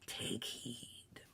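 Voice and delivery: soft, whispery ghost voice; hushed spirit. A narrator's reading. Ghost warning Scrooge to take heed, hushed. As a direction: breathe the warning softly.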